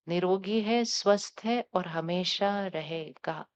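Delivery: gentle meditative affirmations; guided affirmation. Acted. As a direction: lead the group calmly through each vow. Leads affirmations.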